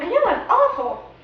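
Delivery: mock guilty. Hammy self-reproach.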